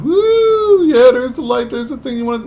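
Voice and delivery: high shrieking whoop of delight; excited whoop. Retold or imitated. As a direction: cheer like a kid at a light show. Excited cheer with wand.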